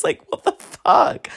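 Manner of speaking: high, slow what the fuck; mock outrage. To the ex, high slow mock outrage. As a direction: drag it out, disgusted.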